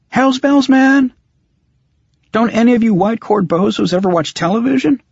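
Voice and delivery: high, fast exasperated yelling; exasperated shout. Acted. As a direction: throw up your hands and shout. Exasperated outburst at vampire.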